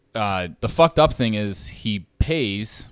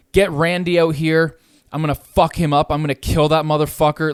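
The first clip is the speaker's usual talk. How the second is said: higher, louder mock shout of threats; imitated threatening shout; 5 semitones higher, 5 dB louder than usual. Retelling the neighbour's recorded threats, imitated shout. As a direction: bark the threats like the raging tenant upstairs. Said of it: "he was saying shit like".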